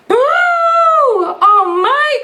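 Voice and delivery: put-on elephant's boo startling the old lady; boo then startled shriek. A narrator's reading. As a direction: shriek like a scared granny. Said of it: "cried the tiny old woman".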